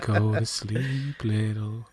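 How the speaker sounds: slow whispered ASMR lull. Pretend. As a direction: soothe them to sleep, slowly. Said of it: "good ASMR voice".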